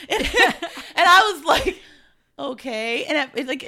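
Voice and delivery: laughing at what her daughter said. Real, not acted. Mother laughing as she retells her daughter's remark.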